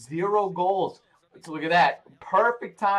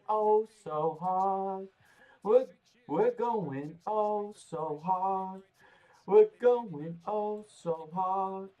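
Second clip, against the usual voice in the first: slow hype drawl; 3 semitones higher, much slower than usual. Acted. Drawn-out hype chant.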